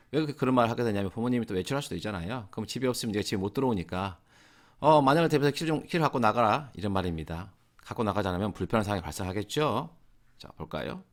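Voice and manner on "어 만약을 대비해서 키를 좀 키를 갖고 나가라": higher, louder, fast parent reminder; mock parent calling to son going out. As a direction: call it after the son heading out. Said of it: "또 나가는 아들에게".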